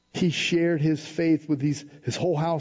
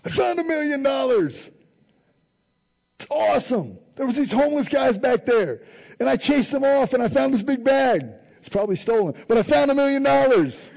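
high, loud giddy bragging; overjoyed finder yelling the news; excited shout; 9 semitones higher, 4 dB louder than usual. Pretend. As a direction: shout it like you hit the jackpot. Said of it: "I'd tell everybody".

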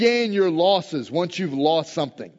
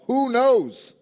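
hopeful cry; 9 semitones higher, 6 dB louder than usual. Retold or imitated. David's desperate hope voiced.

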